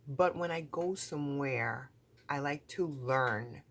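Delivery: usual speaking voice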